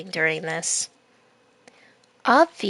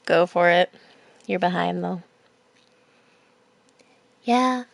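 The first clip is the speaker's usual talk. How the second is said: plain reading voice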